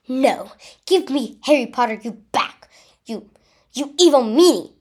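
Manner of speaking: angry yell. A narrator's reading. Girl waving her wand at Voldemort, yelling. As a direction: yell at the villain, building to the insult. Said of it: "I yelled".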